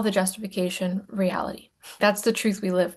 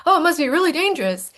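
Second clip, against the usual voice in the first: higher and louder, mock alarm; hammed-up escalating alarm; mock rising panic; 9 semitones higher, 3 dB louder, faster than usual. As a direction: work yourself into a fake panic. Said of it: "the emotional system goes".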